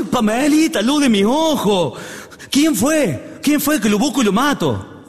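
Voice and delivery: high, drawn-out hammed-up outrage; enraged father. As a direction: demand the culprit like a father gone mad. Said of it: "Don González se puso loco". (padre furioso, exagerado y agudo)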